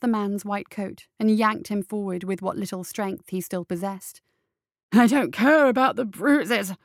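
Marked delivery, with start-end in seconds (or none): 4.9-6.7 loud, strained rattling voice. Acted. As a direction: force it out through a tight throat. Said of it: "he rattled".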